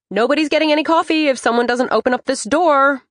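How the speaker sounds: high, loud bossy demand. Acted. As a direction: boss the door open like grandma. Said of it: "I sound bossy".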